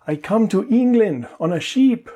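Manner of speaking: hammed-up Spanish learner saying "sheep" for ship; bad Spanish accent imitation; terrible Spanish accent. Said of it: "that terrible Spanish accent".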